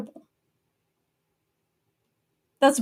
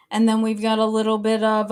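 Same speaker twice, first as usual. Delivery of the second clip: ordinary talk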